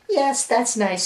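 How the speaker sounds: high-pitched put-on mom voice; mother humoring child. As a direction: placate a small child in a high voice. Acted parent replying to child.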